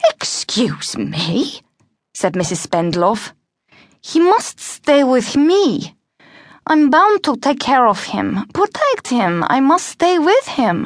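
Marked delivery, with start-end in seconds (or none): 0.1-1.6 soft, very drawn-out disbelief, rising at the end; affronted teacher. Acted. Teacher to a pupil who refused her, affronted. 4.1-10.8 put-on higher, deliberate boy's character voice; insistent, protective Cengiz. Big brother refusing to be parted from him, insistent.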